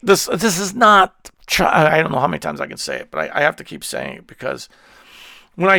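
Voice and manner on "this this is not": usual speaking voice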